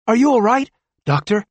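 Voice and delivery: high, loud, worried call; alarmed. Acted. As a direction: rush to the collapsed doctor. Nurse alarmed at collapsed doctor.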